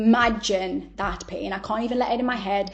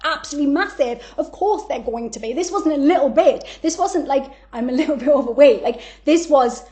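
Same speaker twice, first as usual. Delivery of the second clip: loud, higher, incredulous venting; exasperated rant; 4 semitones higher, 5 dB louder than usual. Retold or imitated. Telling a friend the psychic's guess was obvious, exasperated. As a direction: pile it on in disbelief, punching the words.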